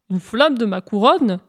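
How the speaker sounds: astonished echo, swooping on the words; incredulous. Acted. Sun questions the hare's request, incredulous. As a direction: query it in disbelief.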